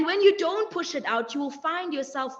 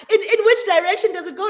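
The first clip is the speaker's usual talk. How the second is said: laughing, louder and higher, fast; 6 semitones higher, 8 dB louder, faster than usual. Real, not acted. Recalling childhood sweeping confusion, laughing. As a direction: laugh through the question.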